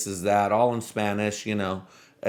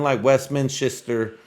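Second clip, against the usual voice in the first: ordinary talk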